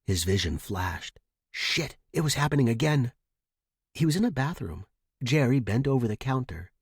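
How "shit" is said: ordinary delivery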